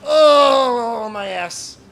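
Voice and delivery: pained groan. Real, not acted. Streamer complaining of a sore behind, long pained groan. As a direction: draw out a loud moan, then drop off.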